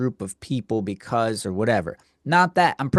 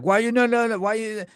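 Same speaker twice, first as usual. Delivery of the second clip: high-pitched sputtering imitation; hammed-up high babble of complaint; mocked angry customer; 7 semitones higher than usual. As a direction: mimic a customer sputtering in protest. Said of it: "He walks up. He's like".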